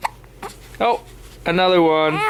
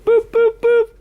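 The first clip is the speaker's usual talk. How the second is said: squeaky high boops; 3 dB louder, slower than usual. Real, not acted. Parent playing with hiccuping baby.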